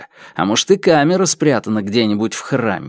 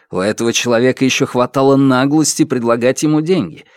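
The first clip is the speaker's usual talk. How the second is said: ordinary delivery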